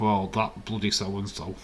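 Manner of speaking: ordinary talk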